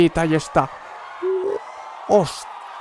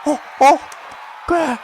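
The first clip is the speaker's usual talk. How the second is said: high excited shouting; excited yelling; 10 semitones higher than usual. Real, not acted. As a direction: yell it out, thrilled. Streamer yells at Scooby-Doo reveal.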